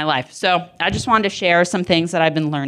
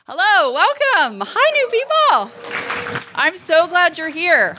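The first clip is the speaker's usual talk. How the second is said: very high, drawn-out sing-song greeting; gushing welcome; 11 semitones higher, slower than usual. Real, not acted. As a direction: squeal a delighted hello to the newcomers. Greets newcomers in the crowd.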